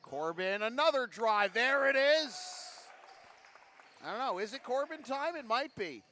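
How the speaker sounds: loud, high-pitched call of a big basket; excited play-by-play. Real, not acted. Commentator calls Corbin's drive as it drops, excited. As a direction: let the call leap up with the score.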